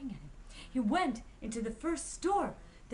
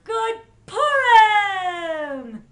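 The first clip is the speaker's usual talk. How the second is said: loud, high, long-held holiday shout; exuberant shout; 9 semitones higher, 20 dB louder, much slower than usual. A narrator's reading. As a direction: belt it out, stretching Purim. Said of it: "Pinchas shouted out".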